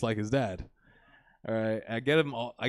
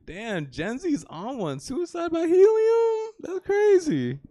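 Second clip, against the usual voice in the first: slow, rising bystander voice, higher and louder on the last words; onlookers' voice; 13 semitones higher, 4 dB louder, slower than usual. Retold or imitated. Passers-by watching him drive with balloons, slow and amazed. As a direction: drawl it like a stunned passer-by. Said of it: "people on the side of the road being like".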